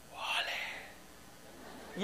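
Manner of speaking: a soft unvoiced ole to the child; whispered ole. Parent whispering to child on the table, whispered. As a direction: breathe it out as a whisper.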